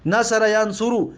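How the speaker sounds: normal talking voice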